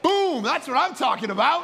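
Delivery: high, loud, punchy celebration; triumphant cheer. Retold or imitated. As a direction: burst out delighted at the payoff. Pastor delighted by the story's payoff.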